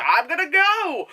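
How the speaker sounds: high, excited exclamation; hammed-up eager cry; mock eagerness. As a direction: shout it like you're off right now. Acting out eager response.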